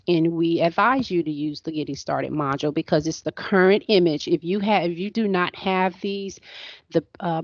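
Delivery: ordinary delivery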